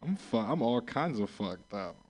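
ordinary talk, nothing marked

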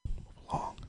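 whispered aside. Real, not acted. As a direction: breathe it out under your breath. Muttered dismissal.

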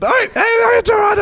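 high shouted hello. Retold or imitated. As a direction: shriek the wrong name cheerfully. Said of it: "they're like".

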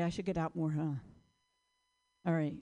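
ordinary talk, nothing marked

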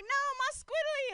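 whiny squeak. Retold or imitated. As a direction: protest like a child losing a toy. Friend clings to awning vs security.

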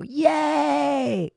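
high, long drawn-out cheer; group cheer. Acted. The boys cheering Prudence on, high cheer. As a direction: stretch it out as a celebrating chorus. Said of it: "And they all go".

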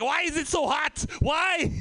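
hammed-up German accent as a Swede; Swedish accent. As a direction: fake German-sounding accent passed off as Swedish. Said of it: "That's a German accent, pretend to be a Swedish accent".

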